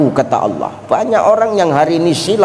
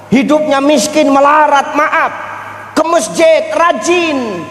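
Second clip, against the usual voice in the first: preaching at full force; 10 semitones higher than usual. Acted. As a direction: preach the story to the crowd. Raised preaching on Sa'labah. (berceramah dengan suara tinggi dan lantang)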